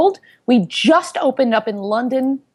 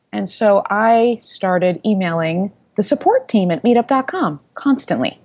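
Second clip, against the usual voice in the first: ordinary talk, nothing marked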